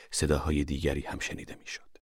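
ordinary delivery

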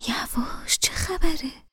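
whispered, startled shush; quiet him down fast; father whispering to hush Amir. Acted. جا خورد.

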